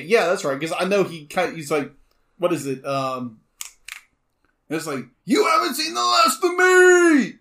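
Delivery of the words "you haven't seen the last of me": high, slow villain shout; mustache twirling villain. Retold or imitated. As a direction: shout it like a cartoon villain vowing revenge. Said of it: "so he's gonna be a villain".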